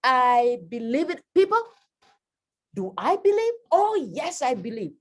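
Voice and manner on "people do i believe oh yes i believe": much higher, fervent and loud; passionate belief. Shown as a demonstration. Teacher showing passionate conviction to the audience, high and fervent. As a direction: proclaim it to the crowd. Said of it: "That's the difference".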